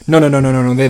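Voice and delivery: ordinary talk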